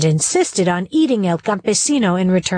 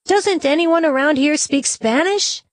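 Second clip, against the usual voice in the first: put-on higher, exasperated child's question; frustrated; 7 semitones higher than usual. Nieto, ignored by the animals, asking in frustration. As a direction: throw up your hands in mild exasperation.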